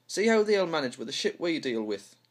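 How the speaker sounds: normal talking voice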